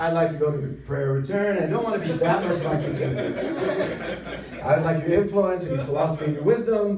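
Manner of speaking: loud mock complaint with laughter creeping in; comic loud bargaining. Preacher jokingly asks Jesus for a prayer return, loud mock complaint. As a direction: plead your case loudly, playing for laughs.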